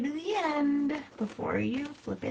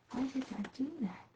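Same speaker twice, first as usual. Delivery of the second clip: normal talking voice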